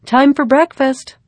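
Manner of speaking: mother calling across the house. A narrator's reading. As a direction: call the girls to the table. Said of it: "Mother called".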